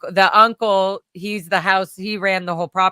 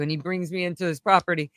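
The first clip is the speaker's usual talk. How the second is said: normal talking voice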